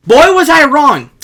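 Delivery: a sudden loud, high shout of regret; loud exclamation. Real, not acted. Skier admits he was wrong, in a loud high shout. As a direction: blurt it out loud, laughing at yourself. Punchline after thinking falls won't hurt.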